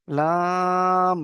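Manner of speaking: very slow recitation of letter names; drawn-out letter recitation. Shown as a demonstration. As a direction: stretch each letter name long and sung. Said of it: "আমি নোক্তা বাড়িয়ে".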